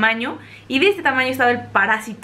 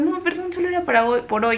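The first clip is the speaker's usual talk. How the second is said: apologetic; 6 semitones higher than usual. Retold or imitated. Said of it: "Súper apenada".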